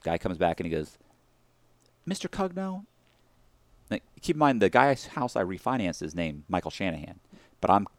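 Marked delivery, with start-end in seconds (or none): 2.1-2.8 higher, softer inquiry; lightly voiced manager calling a name; a hint of a prim bank manager; mock manager. Manager calls his name.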